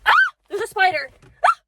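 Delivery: startled shriek at a spider; sudden loud scared yelp; screaming at a spider. Real, not acted. Spots a spider.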